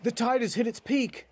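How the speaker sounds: high-pitched mock yell; acted shout from the shoreline; narrated yell. A narrator's reading. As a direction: call it out high, without much extra volume. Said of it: "yells out".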